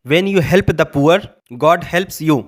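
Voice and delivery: very slow, careful English; careful classroom reading; slow dictation. Shown as a demonstration. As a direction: lay each word down for learners. Teacher dictating English example.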